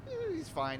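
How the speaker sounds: high, soft, offhand brush-off; retold dismissiveness. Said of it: "It was just like".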